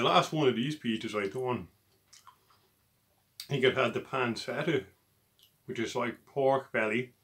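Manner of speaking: speaking between bites; talking while chewing. Real, not acted. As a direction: mouth partly full of food. Seen 2: Bitten slice held at mouth throughout; mouth busy between bites.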